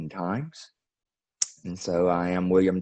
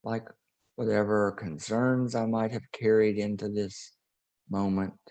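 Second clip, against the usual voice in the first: guided meditation; 2 semitones higher than usual. Acted. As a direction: ease the listeners into breathing and release.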